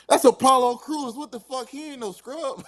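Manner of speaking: high-pitched, stunned exclamation; shocked disbelief. Retold or imitated. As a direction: squeal it like you can't believe it. Shocked on seeing wrestler's photo.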